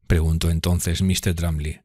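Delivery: ordinary delivery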